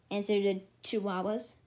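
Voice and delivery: plain reading voice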